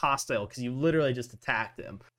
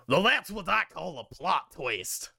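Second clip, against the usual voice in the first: higher, put-on captain voice; hammed-up captain with swooping pitch; ship captain; 5 semitones higher than usual. Captain addresses the whole party, higher put-on character voice. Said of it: "and he says".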